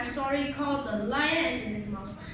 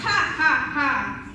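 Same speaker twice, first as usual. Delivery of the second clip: lion's laugh; 6 dB louder than usual. Acted. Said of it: "The lion laughed".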